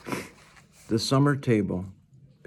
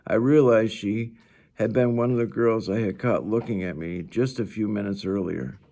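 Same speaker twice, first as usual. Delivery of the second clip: ordinary delivery, nothing marked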